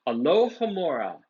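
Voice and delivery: usual speaking voice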